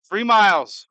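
urgent. Real, not acted. Racing the storm to shelter.

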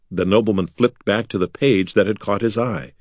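usual speaking voice

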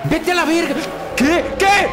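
high, startled outbursts; shocked panic. Real, not acted. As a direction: yelp it in disbelief and alarm. Jump scare in game. (sobresalto con pánico)

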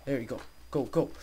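ordinary talk, nothing marked

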